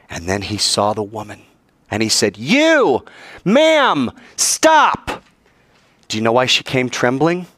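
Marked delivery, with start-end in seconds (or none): 2.4-5.2 high, loud stern command; stern call. Acted. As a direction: call her out sharply to halt her. Jesus calls out to the woman.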